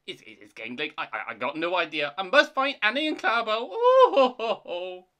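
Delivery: stammering, then high, loud and fast; panicky excuses. Acted. Thomas flees the ghost, panicky. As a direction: build from stutter to frightened wail.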